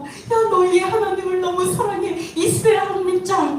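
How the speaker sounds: high-pitched, playful adoration; acted gushing praise. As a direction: gush like an excited new believer. Acts out Gentiles praising God.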